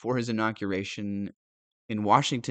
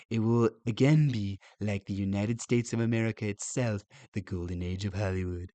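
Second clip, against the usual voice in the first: put-on Donald Trump accent; 3 semitones lower than usual. Reader restarting the president's post to make it easier, Trump accent. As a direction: read it out in a Trump-style accent. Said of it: "start all this over in the accent".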